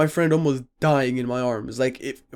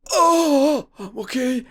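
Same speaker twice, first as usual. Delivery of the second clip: imitated moaning; 10 semitones higher, much slower than usual. Acting the friend after the crash, high mock moan. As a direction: mimic winded friend insisting through a groan. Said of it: "He's just moaning".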